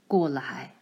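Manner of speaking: low, stern call; low and grave, a father about to scold; father calls his daughter over, low and stern. A narrator's reading. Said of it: "他的样子好可怕".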